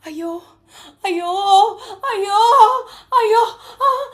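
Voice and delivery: high ayo rising to loud crying ayos; a hammed-up mommy moan; South Indian mommy moan. Shown as a demonstration. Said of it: "The South Indian mommy moan".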